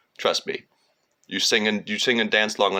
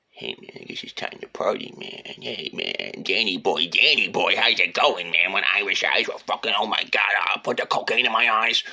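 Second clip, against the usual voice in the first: hammed-up partying dude, getting louder; mock party Irishman; 4 dB louder than usual. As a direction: play a drunk reveler calling out to a buddy. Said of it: "the spirit of rebellion often goes like".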